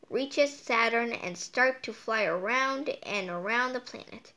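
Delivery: ordinary delivery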